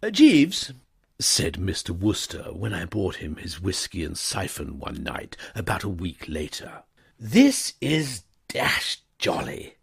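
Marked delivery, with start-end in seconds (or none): 0.0-0.7 high, loud genial call; young toff. A narrator's reading. Wooster voiced. 7.2-9.8 high, slow, cheerful gentleman; cosy young gentleman; contented toff. A narrator's reading. Wooster voiced.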